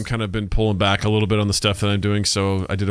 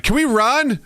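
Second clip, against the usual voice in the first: much higher, eager ask; 12 semitones higher, slower than usual. Retold or imitated. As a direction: pitch it up like an excited kid. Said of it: "Natalie's like".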